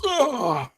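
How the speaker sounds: very high cry of pain; acted high-pitched howl; bitten scream. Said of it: "it bites him and he goes".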